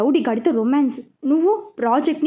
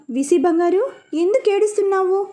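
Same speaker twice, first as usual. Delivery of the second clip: high, urgent worried questions; alarmed caller comforting a crying girl; worried elder on the phone to a crying girl; anxious; 7 semitones higher than usual. Acted. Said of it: "అటు నుంచి కంగారుగా".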